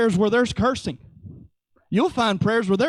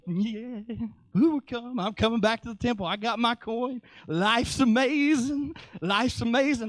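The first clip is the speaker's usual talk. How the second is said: dreamy, softly delighted disciple; elated Peter; 4 dB quieter than usual. Acted. Preacher playing Peter back from the temple, blissfully amazed. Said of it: "He's just like".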